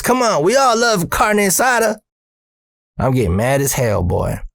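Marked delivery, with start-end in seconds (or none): 0.0-2.0 high-pitched needling boss voice; pitched up, cajoling mockery; Earl teasing. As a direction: imitate a boss cracking a joke at a worker. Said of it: "And he goes".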